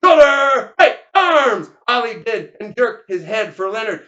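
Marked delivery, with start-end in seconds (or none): 0.0-1.7 high, loud drill call; yelled command. Acted. Wayburn to the squad, yelled drill order. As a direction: yell each word as an order. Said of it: "B L Wayburn yelled".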